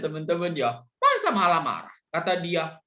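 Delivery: ordinary talk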